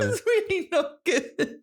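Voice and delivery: slow, high words through laughter; helpless laughter. Real, not acted. Storyteller still reacting to the punchline, helpless laughter. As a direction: struggle to speak while laughing.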